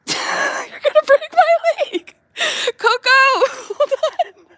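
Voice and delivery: high, slow squealing laugh through the words. Real, not acted. Owner squeals with laughter as the dog chews her leg. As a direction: squeal while laughing helplessly.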